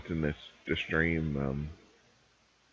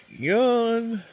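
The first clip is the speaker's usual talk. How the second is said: mock yawn.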